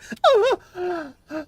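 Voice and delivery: sharp, high gasp of pain. Acted. Rivers gasping as Hidak slices her back, sharp high gasp. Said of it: "and you hear".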